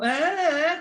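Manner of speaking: hammed-up bawling; mock crying. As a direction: imitate someone bursting into tears. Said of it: "começou a chorar".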